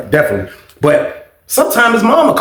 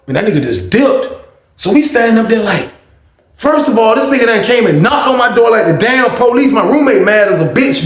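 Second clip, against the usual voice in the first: usual speaking voice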